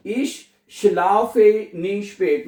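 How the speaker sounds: Wir schlafen nie spät, each word drawn out; slow teaching demo. Shown as a demonstration. Teacher modelling the German sentence, very slow. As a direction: model the sentence slowly for learners. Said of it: "अच्छा, मैं कभी भी देर से नहीं सोता".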